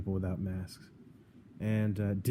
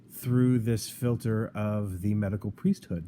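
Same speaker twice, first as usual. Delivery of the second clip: ordinary delivery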